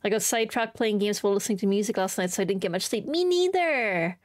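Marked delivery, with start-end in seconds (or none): 3.1-4.2 high, drawn-out exclaim; excited. Real, not acted. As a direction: burst out in agreement. Excited agreement.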